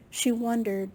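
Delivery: usual speaking voice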